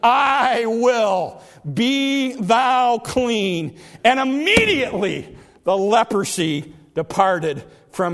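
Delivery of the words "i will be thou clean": slow, drawn-out solemn pronouncement; Jesus. Retold or imitated. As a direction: stretch each word with authority. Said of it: "Jesus put forth his hand and touched him, saying".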